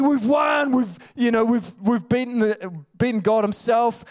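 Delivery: high, triumphant mock demon crowd; gloating demons. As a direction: crow over the victory like cocky demons. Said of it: "They were like".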